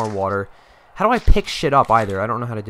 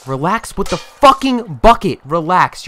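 frustrated yelling; 5 semitones higher, 6 dB louder than usual. Real, not acted. Yelling at clumsy character.

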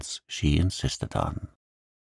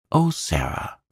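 higher-pitched exclamation; exclaiming; 4 semitones higher than usual. A narrator's reading. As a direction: exclaim it, caught out. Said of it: "she exclaimed".

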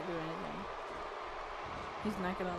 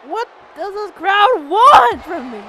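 loud, high, drawn-out question; slow, swelling wail of exasperation; exasperated plea; 5 semitones higher, 11 dB louder than usual. Real, not acted. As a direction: cry out in frustration. Pleading about the crowd.

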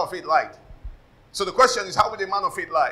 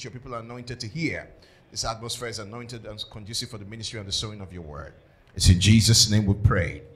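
low, soft prayer in a steady flow; opening prayer; 7 semitones lower, 7 dB quieter than usual. Real, not acted. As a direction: pray quietly over the congregation before teaching. Leads prayer before the sermon.

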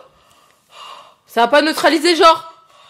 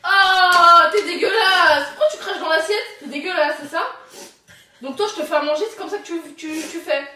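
mock angry scolding; 7 semitones higher than usual. Prank, fake scolding over spitting.

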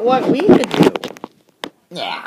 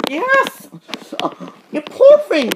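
much higher, louder cry of pity; 8 semitones higher, 5 dB louder than usual. Acted. Nurse crying out in pity for the hurt engine. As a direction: pity him out loud.

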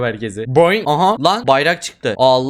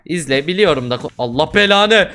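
usual speaking voice

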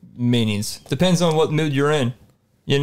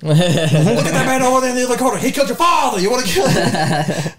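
higher, laughing egging-on voice; mock hype man; 7 semitones higher than usual. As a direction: goad him, half laughing. Acting a goading hype man.

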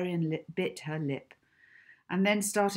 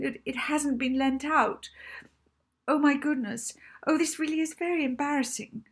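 high, flustered, stumbling apology; nervous librarian; 9 semitones higher, 6 dB louder than usual. Acted. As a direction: babble the excuse in a panic. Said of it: "words tumbled nervously out of her mouth".

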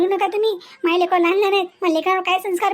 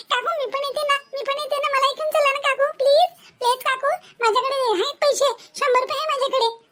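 put-on child voice; 7 semitones higher than usual. Child begging aunt to come along.

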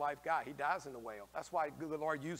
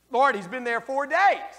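loud, higher, slow protest; Martha protesting; 10 semitones higher, 13 dB louder, slower than usual. Acted. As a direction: object to the Lord loudly and slowly. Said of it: "what does she say".

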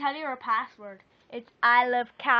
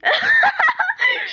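loud, piercing laugh; very high squeal of laughter; high shrieking laugh; 14 dB louder than usual. Real, not acted. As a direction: burst out in a sustained high cackle. Bursts out during accent demo.

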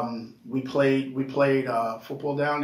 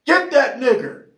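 loud, high hostile yell; yelled slur; 11 semitones higher, 7 dB louder than usual. Retold or imitated. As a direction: holler it out across a football field. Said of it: "he yells out".

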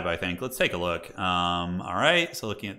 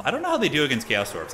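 ordinary talk

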